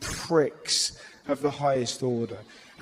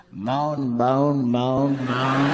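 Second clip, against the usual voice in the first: mock moaning; 4 semitones lower, much slower than usual.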